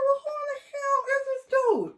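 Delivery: high-pitched who-is-this disbelief; shocked disbelief. Retold or imitated. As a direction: squeak it out in mock shock. Retells her shocked reaction.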